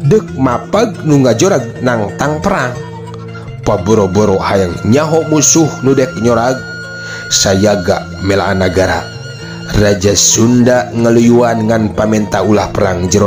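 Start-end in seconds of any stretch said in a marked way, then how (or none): none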